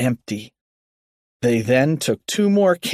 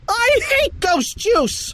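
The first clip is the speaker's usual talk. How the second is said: high, drawn-out yell; panicked yell; slower than usual. A narrator's reading. Finkstein covered in foam, high panicked yell. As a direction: cry out slowly in high disgusted panic.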